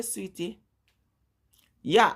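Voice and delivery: ordinary delivery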